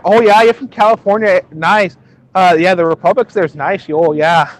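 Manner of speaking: hammed-up Midwestern "oh yeah"; Midwestern-Canadian accent. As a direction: play a chirpy Midwest local with Canadian vowels. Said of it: "all of the Midwest, including Canada".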